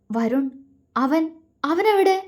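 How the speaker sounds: halting, climbing to a high question; acted anxious faltering; fearful question. A narrator's reading. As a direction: ask hesitantly, afraid of the answer. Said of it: "അവൾ പേടിയോടെ ചോദിച്ചു".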